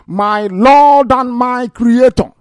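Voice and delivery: high, slow cry to the Creator; anguished invocation. Real, not acted. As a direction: draw out each word, calling up to heaven. Cries out to God in outrage.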